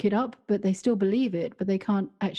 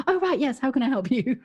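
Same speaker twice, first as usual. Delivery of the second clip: much higher, startled chirpy reset; flustered snap back; 5 semitones higher than usual. Retold or imitated. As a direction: jolt awake and chirp a customer greeting, pitched way up. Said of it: "she went".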